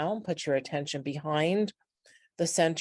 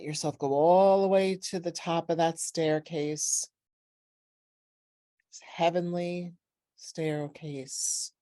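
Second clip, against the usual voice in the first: guided meditation. Real, not acted. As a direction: guide them gently upward, letting each phrase settle.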